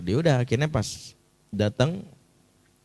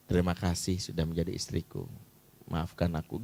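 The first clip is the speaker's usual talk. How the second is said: low, soft heartfelt thanks; tender; 5 semitones lower, 6 dB quieter than usual. Pretend. Husband thanking and apologising to his wife, tender. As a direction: lower the voice and thank gently.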